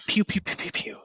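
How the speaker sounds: whispered laser noises; whispered pew pew. Pretend. Playful sound effect.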